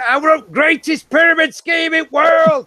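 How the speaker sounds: high, loud cartoon villain shout. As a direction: ham it up as a greedy villain. Mocking pyramid scheme villain.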